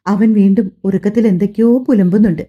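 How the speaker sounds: usual speaking voice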